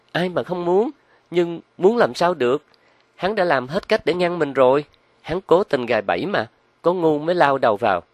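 high-pitched, protesting woman's voice; exasperated woman accomplice. Acted. Loan tells her partner the scheme is trapped, exasperated.

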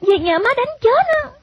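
put-on high-pitched girl lamenting between breaths. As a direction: pant out the worry in a child's high voice. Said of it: "Trà vừa thở vừa nói".

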